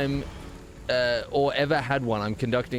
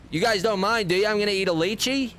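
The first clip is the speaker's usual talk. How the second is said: much higher, mock-polite asking; mocking imitation; 10 semitones higher than usual. Streamer posing as someone who says LEE-chee, mocking imitation. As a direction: put on a high voice to ridicule LEE-chee.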